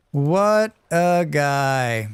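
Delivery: drawn-out. Pretend. Savoring phrase while gaming.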